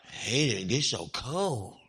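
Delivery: slow, low grumble about cold; hammed-up weary griping; mock complaining. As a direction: imitate a dreary complainer, dragging the words. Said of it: "we complain about weather".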